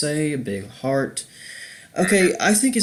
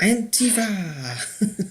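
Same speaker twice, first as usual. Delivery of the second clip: dramatic drawn-out call; 10 semitones higher, much slower than usual. Acted. Mock dramatic Antifa call.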